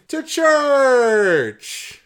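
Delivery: high, loud, drawn-out holler; stretched-out mock-triumphant yell; mock-dramatic shout. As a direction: blow it up into a huge silly reveal. Mock-dramatic reveal of destination.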